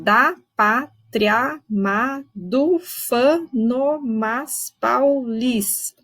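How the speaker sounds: very slow, syllables stretched and run together; slow metrical reading; slow scansion. Shown as a demonstration. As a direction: read the verse slowly to count it. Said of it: "Esse verso é um pouquinho mais difícil de contar".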